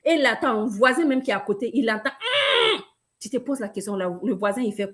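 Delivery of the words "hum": imitated toilet strain, high grunt. Seller mimicking a constipated person on the toilet, straining grunt. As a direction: push out a high, effortful "hum". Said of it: "des fois tu concentres".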